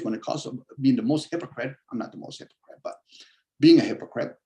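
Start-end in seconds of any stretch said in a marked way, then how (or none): 1.9-3.0 hushed half-to-himself; soft whispered qualification; whispered aside. Real, not acted. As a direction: whisper it as a quick correction. Whispered self-correction aside.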